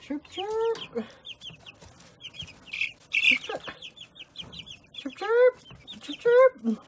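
very high chirping calls; chick chirps. Shown as a demonstration. Owner chirping high to get chicks to answer. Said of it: "get one to do this".

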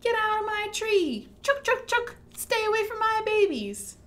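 high, loud chittering squirrel warning; fierce mama squirrel. Acted. Mama squirrel scaring animals from her babies, high loud fierce. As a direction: chase off the big animals from her tree. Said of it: "So she says".

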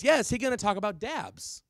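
high, loud, quick blurt; rapid-fire imitation of a kid calling out; fast kid's question. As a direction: fire off the question like an eager pupil. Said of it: "said it that fast".

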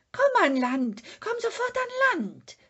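loud call rising to a high shriek; screaming. Acted. Sniff screams at Moomin to come ashore, rising to a shriek. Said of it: "schrie das Schnüfferl".

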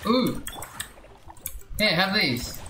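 ordinary delivery

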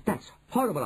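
understated indignant cry; mild outcry. A narrator's reading. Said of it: "I cried".

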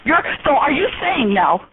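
ordinary talk

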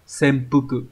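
ordinary talk

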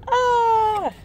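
sudden high squeal of delight; a burst of surprised joy; delighted cry. Real, not acted. Seeing her friend's gift, high delighted squeal.

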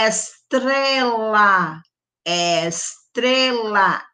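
estrela drawn out slowly; slow teaching word. Shown as a demonstration. Teacher modelling estrela slowly for children. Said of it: "Estrela começa com".

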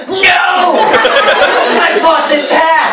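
high, slow, outraged cry over the hat; indignant yell. Retold or imitated. Joe defends his hat.

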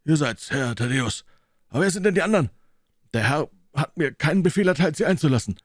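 high, loud stubborn porter voice; a guard who won't budge; gruff gatekeeper. Acted. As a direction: doorman refusing, loud and high. Gatekeeper McMurdo refuses entry.

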